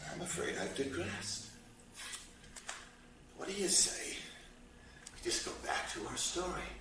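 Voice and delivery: very soft, low, confiding murmur; hushed aside. Acted. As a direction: drop right down as if confiding in the audience.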